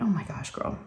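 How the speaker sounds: breathy whisper of mock despair; whispered exasperation. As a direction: whisper it like an amused sigh. Mom reacting to bored daughter.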